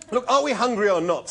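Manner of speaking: loud, high, impatient demand; snapping. A narrator's reading. Zaphod cutting off the debate, snapping. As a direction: snap it loudly, out of patience. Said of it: "snapped Zaphod".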